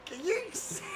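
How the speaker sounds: high, breathless laughing speech. Real, not acted. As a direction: talk through real laughter. Laughing at winner coincidence.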